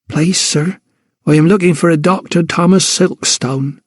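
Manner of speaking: a thin, small timid voice. Acted. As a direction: ask in a thin, meek voice. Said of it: "she said in a thin voice".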